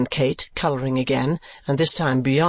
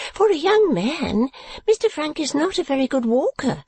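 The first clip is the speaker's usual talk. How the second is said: high, knowing spinsterly chatter; sly little woman; 9 semitones higher than usual. A narrator's reading. Visitor teasing Kate about her admirer, slyly knowing. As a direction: remark it high, with innocent slyness. Narrator voices Miss La Creevy.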